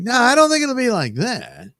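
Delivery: high, put-on voice of disbelief; mock retort. Vlogger mocking the AI image choices, high silly voice. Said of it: "It's like".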